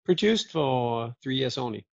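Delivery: ordinary talk, nothing marked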